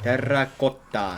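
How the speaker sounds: terracotta with rolled r and rounded o, slowly; Latin pronunciation. Shown as a demonstration. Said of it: "would it be probably in Latin".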